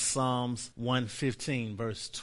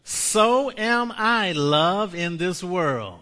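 slow and louder, higher start; slow proclaimed confession; 5 semitones higher, 6 dB louder, much slower than usual. Shown as a demonstration. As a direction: lead the church in it slowly. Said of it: "Say this with me".

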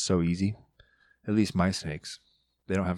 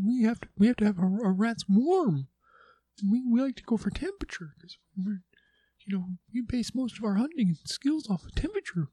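much higher, cocky little snake voice; Little Snake's voice; 12 semitones higher than usual. Acted. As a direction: talk like a tiny sassy pet python. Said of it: "that was Little Snake's voice".

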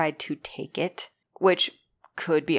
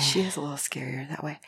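soft unvoiced whisper; 2 semitones lower, 5 dB quieter, faster than usual. Real, not acted. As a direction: murmur it like a secret. Whispered agreement.

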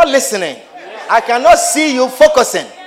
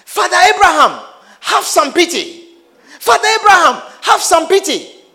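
high, loud desperate pleading; pleading rich man; 7 semitones higher, 3 dB louder than usual. Acted. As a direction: beg for mercy from the flames. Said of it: "The rich man shouted".

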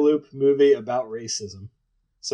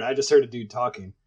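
ordinary talk

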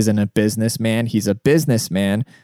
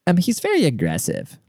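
higher put-on lady voice; lightly voiced neighbor lady; imitated neighbor lady; 7 semitones higher than usual. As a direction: imitate the neighbor's complaint, pitched up. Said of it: "And she's like".

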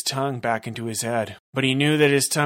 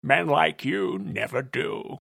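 creepy villain; 7 semitones higher than usual. Acted. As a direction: relish every word like a creep. Villain monologue.

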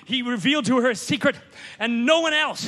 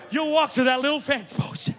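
normal talking voice

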